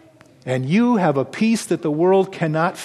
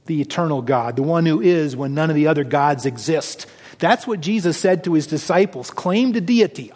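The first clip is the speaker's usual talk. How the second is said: usual speaking voice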